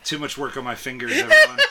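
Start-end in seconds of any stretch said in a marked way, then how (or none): 1.1-1.6 very high, loud squeal. Acted. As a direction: squeal the word out. Squeal to the room.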